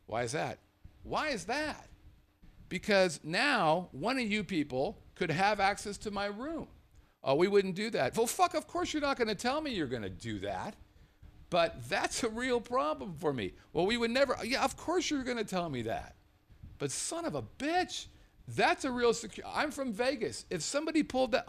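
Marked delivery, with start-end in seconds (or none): 1.0-1.9 incredulous repeat pitched high; higher, disbelieving echo of the manager's question; mock echo. He echoes the question mockingly. 2.7-21.5 higher, exasperated arguing; agitated guest arguing with the manager, pitched up; heated complaint. Retold or imitated.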